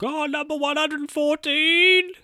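high sing-song call of a fast-food server; calling out order number. Retold or imitated.

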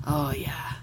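normal talking voice

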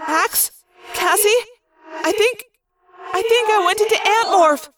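high, hesitant, with echo; frightened cry. A narrator's reading. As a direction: call out to friends, then confess in dread. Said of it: "I cried".

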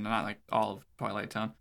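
usual speaking voice

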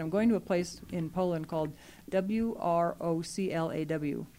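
ordinary delivery

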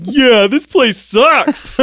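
high, loud, slow hobo drawl. Acted. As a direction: whoop it out like a rowdy hobo. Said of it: "[name]'s like".

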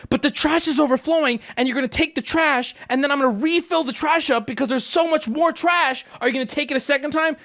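high, insistent complaint; exasperated arguing. Retold or imitated. Customer challenging trash company about overflow, exasperated. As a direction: press the point in worked-up disbelief.

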